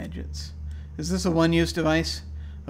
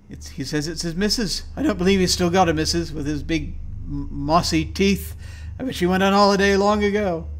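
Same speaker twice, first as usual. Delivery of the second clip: higher, louder, rising paranoid rant; paranoid diary voice; 3 semitones higher, 5 dB louder than usual. Acted. Gamer voicing a paranoid patient's diary, agitated. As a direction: read it like a man losing his grip.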